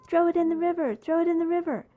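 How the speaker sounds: put-on high, loud chant of egging-on kids; kids yelling. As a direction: shout it like a gang of children urging her. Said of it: "They started yelling at me".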